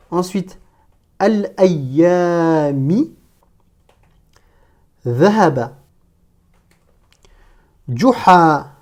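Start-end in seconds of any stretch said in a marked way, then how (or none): 1.2-8.7 each Arabic word set apart by long pauses; careful reading model for learners; detached word-by-word. Shown as a demonstration. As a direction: read out one isolated word at a time. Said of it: "chaque mot de manière détachée".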